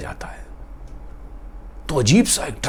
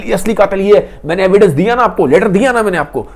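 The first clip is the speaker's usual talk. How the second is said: heated, higher and louder accusation; angry fast testimony; 6 semitones higher, 5 dB louder than usual. Acted. Katerina furiously accusing Dimitri before the judge, angry. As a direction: rush it out in a hysterical fury. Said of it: "बहुत गुस्से से बोल रही है, जोश में बोल रही है, तेज-तेज बोल रही है।".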